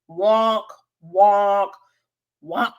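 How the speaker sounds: low mock sad-trombone wonks; imitating a sad trombone sting. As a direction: droop each wonk like a failing horn. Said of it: "I wish I had a sound to insert".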